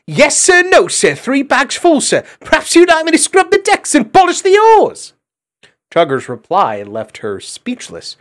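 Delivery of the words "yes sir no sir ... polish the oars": very high, loud sarcastic shout; shouting shrilly. A narrator's reading. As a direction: bellow mock obedience at the little tyrant. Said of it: "shouting shrilly".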